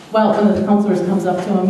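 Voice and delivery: ordinary talk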